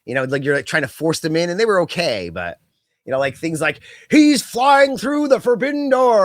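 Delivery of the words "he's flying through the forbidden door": high, slow hammed-up announcer call; mock commentator line. Podcaster mocking a commentator's rehearsed catchphrase, hammed-up announcer voice. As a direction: send up a canned play-by-play one-liner.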